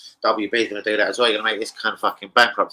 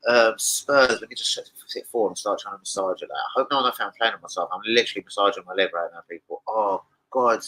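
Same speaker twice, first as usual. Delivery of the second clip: ordinary talk, nothing marked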